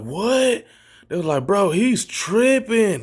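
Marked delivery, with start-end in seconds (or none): 0.0-0.6 high-pitched imitated "what"; mock astonishment. Friends watching him eat strangely, mock astonishment. Friends react to his odd eating. 1.4-3.0 high, drawn-out imitation of friends; mock amazement. Friends remarking on his odd behavior, mock amazement.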